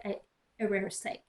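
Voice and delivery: normal talking voice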